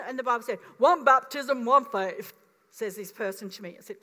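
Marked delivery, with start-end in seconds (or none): 0.8-2.3 louder, emphatic scripture quote; a friend stating scripture emphatically; firm quoting. Retold or imitated. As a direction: lay down the verse. Quoting person citing the Bible.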